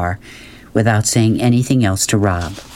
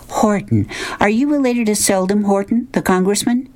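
high-pitched social probing; high, curious mother's voice; inquisitive mother; 11 semitones higher than usual. Acted. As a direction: quiz a newcomer in a high mother's voice. Voices mother questioning boy.

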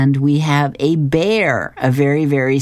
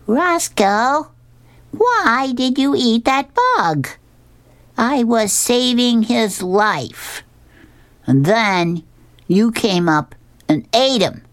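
put-on high, drawn-out little-child voice; put-on small child's complaint; Nelson; 12 semitones higher, slower than usual. A narrator's reading. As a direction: scold the dog as a tiny kid, high and slow. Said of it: "we have Nelson, uh, the tiny grandchild".

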